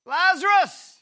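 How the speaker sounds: calling out. Acted. Jesus calling Lazarus from the tomb, loud. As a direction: call him out of the tomb.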